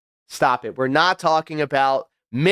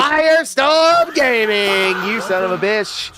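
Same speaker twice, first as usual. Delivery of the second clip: drawn-out shout; 6 semitones higher, 4 dB louder, slower than usual. Acted. Calling out a donor's name.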